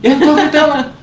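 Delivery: loud, high mock shout; imitated sister shouting in protest; yelled denial. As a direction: yell it while denying any yelling. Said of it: "acabou de gritar".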